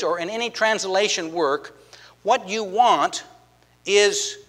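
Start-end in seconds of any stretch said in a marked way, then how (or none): none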